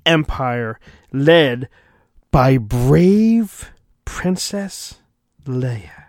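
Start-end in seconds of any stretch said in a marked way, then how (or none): none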